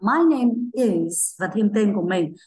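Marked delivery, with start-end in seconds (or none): 0.0-1.4 very slow, clear model answer; slow teacher demo. Shown as a demonstration. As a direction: stretch it out for beginners. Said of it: "các bạn vẫn trả lời cô thế nào nhỉ".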